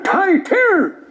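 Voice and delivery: put-on high-pitched, agitated Traveller accent; Irish Traveller accent. Young Traveller challenges a comedian in the street, high Traveller accent. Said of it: "two travelling young fellas".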